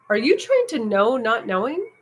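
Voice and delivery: teacher asking pointedly, rising at the end; teacher's question. Retold or imitated. Teacher challenging a frustrated student on retreat, pointed rising question. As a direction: quote the teacher's probing question with a lift. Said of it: "my teacher goes".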